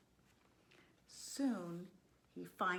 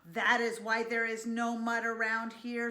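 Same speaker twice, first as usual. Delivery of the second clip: higher, loud indignant discovery; shocked realization; 4 semitones higher, 10 dB louder, faster than usual. A narrator's reading. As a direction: exclaim it loudly in dismay. Pig shocked by vacuum cleaner.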